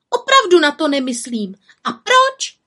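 put-on high, defiant young witch; angry outcry. A narrator's reading. Little witch challenging the raven, angry cry. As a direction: throw it at the raven crossly. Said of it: "vykřikla zlostně".

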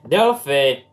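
loud last call of her name; shouted. A narrator's reading. Impatient uncle shouts for his niece one last time. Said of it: "zakřičel naposledy Amos".

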